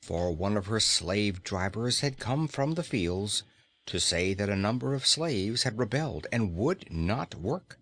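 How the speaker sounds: usual speaking voice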